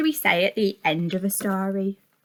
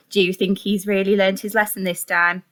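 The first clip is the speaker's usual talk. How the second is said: plain reading voice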